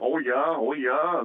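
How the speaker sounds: put-on light Wisconsin accent on yeah, open and drawn out; imitating wife's Wisconsin folks with a mild Wisconsin accent. Husband mocking Oshkosh Wisconsin talk, mild Wisconsin accent. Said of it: "that Wisconsin accent".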